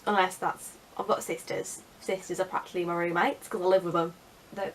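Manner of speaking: normal talking voice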